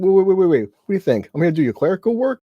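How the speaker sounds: retelling a character shouting in disbelief; indignant outburst. Retold or imitated. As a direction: blurt it out as if insulted. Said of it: "is that how he said it".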